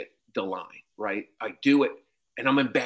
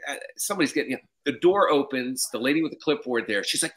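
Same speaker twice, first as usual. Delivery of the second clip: usual speaking voice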